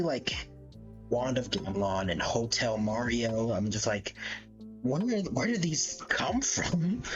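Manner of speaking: ordinary talk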